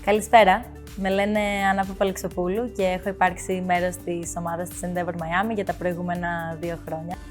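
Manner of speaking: usual speaking voice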